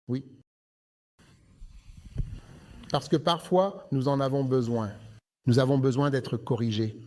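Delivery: preaching. Acted. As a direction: preach with weight. Preacher's sermon delivery.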